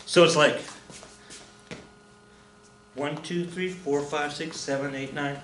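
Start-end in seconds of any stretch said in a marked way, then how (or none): 2.9-5.4 counting one beat too many, softly; soft count that runs past eight; wrong count. Shown as a demonstration. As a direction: count the dance steps softly and overshoot to nine. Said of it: "Nope, that's too many".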